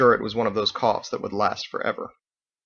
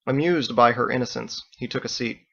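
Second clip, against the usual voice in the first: ordinary delivery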